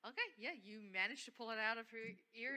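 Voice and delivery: ordinary talk, nothing marked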